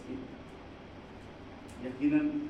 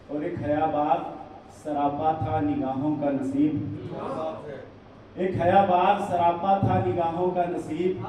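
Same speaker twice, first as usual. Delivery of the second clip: measured, raised mushaira declamation; poetry recital; 4 semitones higher, 11 dB louder, slower than usual. Acted. Poet offering couplets to an appreciative audience, declaimed Urdu verse. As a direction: recite each half-line louder and higher than chat.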